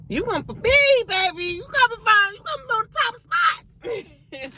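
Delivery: sassy high shout. Acted. Playful boast about being stubborn.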